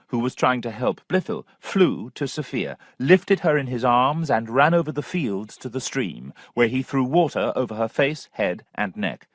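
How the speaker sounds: ordinary delivery, nothing marked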